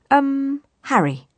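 high, hesitant "um" settling down; nervous. A narrator's reading. Disguised girl answers the captain's question, nervously. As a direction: hesitate nervously before giving the name. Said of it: "replied Harriet nervously".

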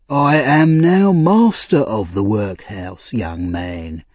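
pompous, slow self-important tone. Acted. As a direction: puff yourself up, speak slowly. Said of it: "slowly and in an important voice".

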